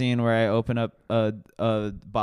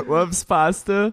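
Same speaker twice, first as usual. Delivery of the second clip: high, slow mock-Irish leprechaun; 6 semitones higher, 4 dB louder, slower than usual. Describing a pasta-loving character, Irish leprechaun accent. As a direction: draw it out in a silly Irish lilt. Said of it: "he's a leprechaun".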